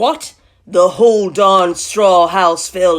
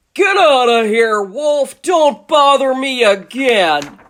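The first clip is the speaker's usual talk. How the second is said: loud, raised, gruffly dismissive shout; rude little pig; 6 semitones higher, 5 dB louder, slower than usual. Acted. Third pig sending the wolf off, rude shout. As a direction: yell the intruder away rudely. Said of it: "what that rude little porker answered".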